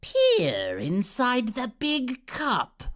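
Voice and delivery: slow, high eerie witch voice; Chaos Witch. A narrator's reading. Witch lures Oxwald toward the cup, eerie whisper. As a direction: stretch each word out, whispery and luring. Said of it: "her voice like a thousand whispers".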